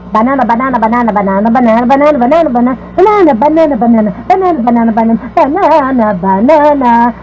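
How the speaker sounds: banana repetido sem parar, em cadência monótona; disco quebrado. Shown as a demonstration. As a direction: repetir banana mecanicamente, emendando uma na outra. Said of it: "como um disco quebrado". (como um disco riscado)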